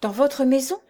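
astonished query; startled question. A narrator's reading. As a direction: ask it as if taken aback. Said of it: "répéta Jeanne".

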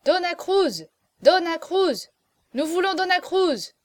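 high, loud chanted calls; crowd shouting. A narrator's reading. Drunken guests in the salon demand Dona Cruz, shouting. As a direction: call out for her like a rowdy crowd. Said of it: "criait-on dans le salon".

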